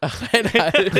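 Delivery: high laughing repeat; laughing echo. Real, not acted. Cohost laughs repeating eight and a half. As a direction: say it through a laugh.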